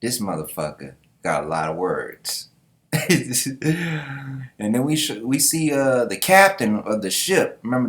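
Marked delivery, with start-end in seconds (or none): 2.9-4.5 amused wheezy chuckle; laugh. Real, not acted. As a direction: laugh at the long-winded speech. Amused by wordy knight.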